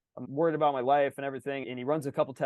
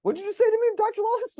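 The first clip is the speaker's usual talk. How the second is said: much higher, louder stunned retort; stunned disbelief; 5 dB louder than usual. Retold or imitated. Retelling reply to the doctor, stunned high disbelief. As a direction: replay the shock of a crazy question, pitched way up. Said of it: "I was like".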